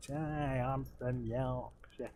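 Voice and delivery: slow, unpleasant mock Yorkshire; sneering Yorkshire accent. Gamer demonstrating his bad habit, in a sneering Yorkshire accent. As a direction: sneer it in exaggerated, dragged-out Yorkshire. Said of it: "adopt the most unpleasant sneering, uh, Yorkshire accent".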